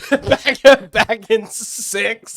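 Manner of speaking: laughing, slow and loud; laughing disbelief. Real, not acted. Laughing echo of friend's line.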